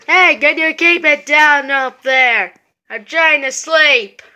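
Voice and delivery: grumpy neighbor yelling down about the noise; loud grumpy neighbor. Acted. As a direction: boom down from upstairs to complain. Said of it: "my voice is unstably loud".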